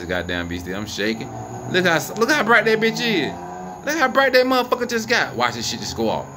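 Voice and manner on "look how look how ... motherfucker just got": startled excitement. Real, not acted. Light suddenly grows brighter.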